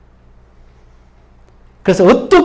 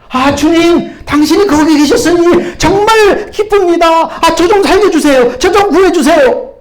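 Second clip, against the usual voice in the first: relieved pleading; 5 semitones higher, 5 dB louder, slower than usual. Acted. Traveler pleads with God.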